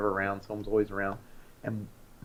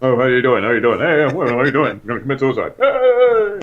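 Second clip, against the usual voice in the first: loud, manic comic voice; Robin Williams impression; 3 semitones higher, 11 dB louder than usual. Doing Robin Williams as a call center agent, loud and manic. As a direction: bounce through it like a hyper comedian.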